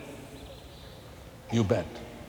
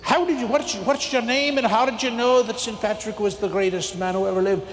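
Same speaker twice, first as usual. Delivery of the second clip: puzzled Irish priest, higher, light brogue; Irish accent; 8 semitones higher than usual. Retold or imitated. Irish teacher quizzing the winning boy, higher Irish accent. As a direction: ask in a raised, curious Irish lilt. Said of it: "fresh from Ireland".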